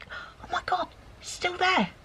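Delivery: hushed, trying not to scare the bird; quiet aside to camera. Real, not acted. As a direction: keep it down so the kingfisher stays. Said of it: "Even with my booming voice".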